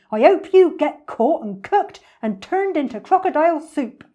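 loud, high-pitched cursing; outraged storybook animal; indignant Humpy Rumpy. Acted. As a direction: hurl the curse at the villain.

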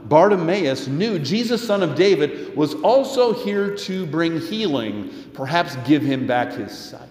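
ordinary talk, nothing marked

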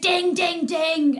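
high, sing-out dinging; mimicked bicycle bell. Annoyed walker imitating cyclists' bells, high mock dinging.

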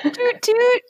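high playful toot toot; train whistle toot. Pretend. Train joke, All aboard.

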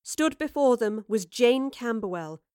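ordinary delivery

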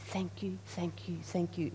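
quiet, tender thank you repeated; soft repeated thanks. Shown as a demonstration. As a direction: murmur it gently like a prayer. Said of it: "important to say".

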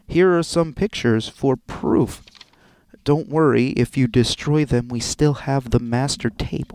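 loud, deliberate letter-reading voice; formal, projected read-aloud; blackmail letter reading. Acted. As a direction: read the threat out in measured, emphatic tones.

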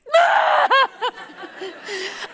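loud high wailing burst; hammed-up sobbing wail; wailing laugh-cry. Bursting into tears, recalled.

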